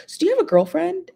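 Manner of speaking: high, quick teasing imitation; mock nosy question. Imitating [name] prying into [name]'s love life, high and quick. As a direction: ask it like a prying gossip.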